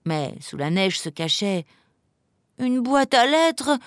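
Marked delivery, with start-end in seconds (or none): 2.6-3.8 slow, high wail of disappointment; moaning. A narrator's reading. Emma uncovering a letter box, moaning. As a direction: groan at yet another wrong find. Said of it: "gémit Emma".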